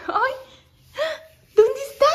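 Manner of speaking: mother asking her daughter about the new puppy, high and sing-song. Real, not acted. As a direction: gush at the little one with a high lilting voice. Excited with toddler.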